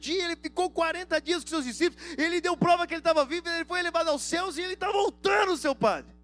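shouting the gospel at the priest; shouting preacher. Retold or imitated. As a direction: yell the good news right in his face. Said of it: "na hora que eu comecei a gritar".

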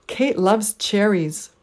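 Kate, loves, cher stressed slowly, ries unstressed; slow Kate loves cherries with a weak ries; deliberate three-beat Kate loves cherries; three stresses, one weak. Shown as a demonstration. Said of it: "three stressed syllables and one unstressed syllable".

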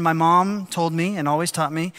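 ordinary talk, nothing marked

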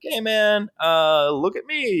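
placating. Retold or imitated. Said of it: "be like".

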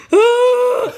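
sudden high yelp into the phone; startled shriek. Retold or imitated. Answering her friend's call with a high startled yelp. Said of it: "I answer it, and I go".

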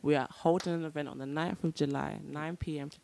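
low, soft seductive voice; sexy voice. Real, not acted. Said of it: "What is this sexy voice?".

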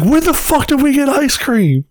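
high-pitched, indignant mock yell; imitated yell. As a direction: yell it like a heckler from the back. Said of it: "this motherfucker just yells from the background".

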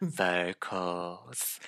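soft, deadpan; quiet critic jargon; reviewer buzzword. Pretend. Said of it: "YouTube music reviewers".

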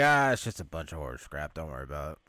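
low-pitched imitation, brushing it off; dismissive guy. Tony brushes off girlfriend's worry, mock dismissive. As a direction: drop low, wave away the worry.

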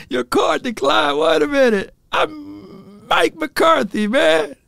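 high, stretched disbelieving wail; mock outrage. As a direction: ham up a shocked coach whose card bounced. Coach acts out card declined.

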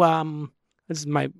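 ordinary delivery

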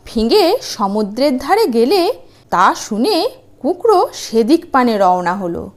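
ordinary delivery, nothing marked

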